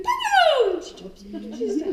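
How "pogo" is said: high loud excited call, Pogo; calling the dog. Retold or imitated. Trainer greeting jumping dog Pogo, loud high call.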